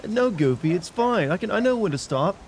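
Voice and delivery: high, swooping cartoon hero; Sora. Sora brushing off Goofy's concern, high insistent voice. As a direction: protest in a high, put-on boyish voice.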